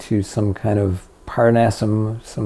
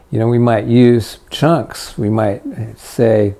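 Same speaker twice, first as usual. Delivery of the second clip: ordinary talk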